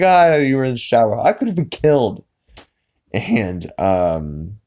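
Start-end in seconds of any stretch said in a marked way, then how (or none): 0.0-2.2 fast, higher-pitched mock outrage; imitated indignant husband. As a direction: protest to the wife in cartoon exasperation. Acted quarrel between cartoon husband and wife.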